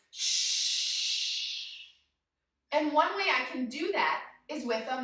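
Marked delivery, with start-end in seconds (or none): 0.1-1.9 shushing. Shown as a demonstration. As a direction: hush the monkeys gently. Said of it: "I wanna tell those crazy monkeys".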